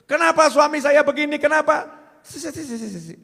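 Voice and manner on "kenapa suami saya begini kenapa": complaining wife. Retold or imitated. Wife complaining about husband.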